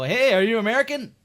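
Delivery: high, loud questioning call; acted eager stranger; mock innocent question. Stranger asking a Canadian if they're American, high loud call.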